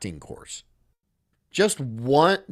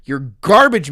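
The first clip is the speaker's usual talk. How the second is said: mock outburst; 8 dB louder than usual. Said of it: "I'm like".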